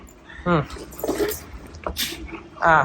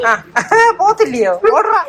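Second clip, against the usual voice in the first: laughing at the dog; 9 semitones higher than usual. Real, not acted.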